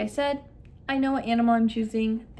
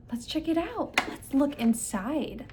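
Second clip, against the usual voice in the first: put-on lilting, drawn-out invitation to a child; inviting child-directed cue; 4 semitones higher, slower than usual. Shown as a demonstration. Clinician cues the child to search the barn, inviting. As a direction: coax a child to look.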